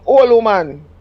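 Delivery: lightly voiced Jamaican husband; a hint of a Jamaican man's voice; Jamaican patois. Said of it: "I'm ten years older than him".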